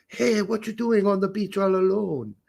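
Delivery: badly faked Jamaican accent, pitched high. Beach pickup of a co-host's wife, bad Jamaican accent. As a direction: put on a dodgy Jamaican come-on. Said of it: "That was my Jamaican accent".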